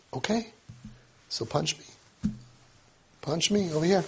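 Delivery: soft, slow "so punch me"; calm invitation. Retold or imitated. Rabbi inviting the frustrated boy to punch him, soft and calm. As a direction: offer yourself quietly. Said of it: "I said".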